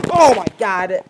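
high, very loud shriek of terror; terrified scream. Real, not acted. Gamer screaming at a sudden jump scare. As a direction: scream it as the monster jumps out.

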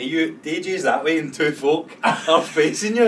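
can't believe what he's hearing; laughing disbelief at the rave couple. Real, not acted. Friend reacts to the rave story.

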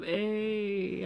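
"Ay" said as a clean ay with no y sound; ay not yay. Shown as a demonstration. Explaining her own hype call to viewers, "Ay" without a y. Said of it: "you think I'm saying yay".

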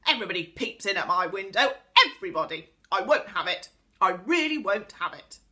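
loud, furious outburst; angry yell. A narrator's reading. Angry pixie at the window catches a peeper, yelling. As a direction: yell it out in a temper. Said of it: "He opened it and yelled loudly".

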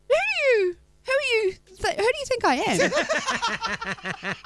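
high, halting puzzled question; imitated bewilderment. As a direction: stumble into the question, voice up, laughter creeping in. Retold husband baffled at being recognised.

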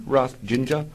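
ordinary talk

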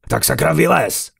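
loud, high demanding shout; angry yell. A narrator's reading. Carlos ordering the hidden caller out, angry yell. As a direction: yell at it to come out. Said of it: "Na koho to řveš?".

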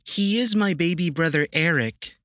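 put-on high, proud child's voice; a small girl proudly introducing her brother; smiling little girl. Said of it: "she answered with a smile".

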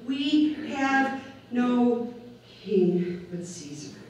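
drawn out, every word weighed; slow declaration. Retold or imitated. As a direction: let the crowd's verdict land slowly. Crowd quoted, rejecting their king.

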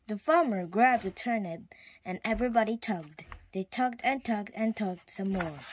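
plain reading voice

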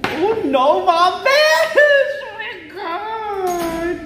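loud rising squeal, then drawn-out high cry; overwhelmed excitement. Real, not acted. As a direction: shriek to the viewers in happy disbelief. Overwhelmed on seeing the phone. (tiling hindi makapaniwala sa tuwa)

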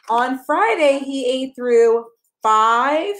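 bright, sing-song picture book reading; reading to a child. Acted. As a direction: read it like bedtime story time for a toddler.

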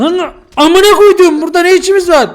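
high, very loud angry yell; enraged, high-pitched shouting; furious shout. Real, not acted. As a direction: explode at your friend at full volume. Yelling until told to calm down.

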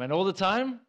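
"all the time" thrown out high and loud with a rising tune; loud prompting question. Real, not acted. Preacher urging congregation to shout back, loud rising prompt. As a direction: call for the crowd to answer "all the time".

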